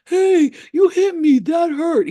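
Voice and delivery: loud, high, drawn-out complaint; hammed-up hurt protest from a giant; huge wounded alien. Punched alien protesting to the elf, loud hurt voice. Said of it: "He was huge".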